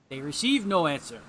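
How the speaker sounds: ordinary talk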